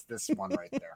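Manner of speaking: soft, light Northwoods Wisconsin lilt; quiet Northwoods Wisconsin accent demo. Shown as a demonstration. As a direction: let a hint of the Northwoods Wisconsin accent slip out. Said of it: "every once in a while, you hear it out of me".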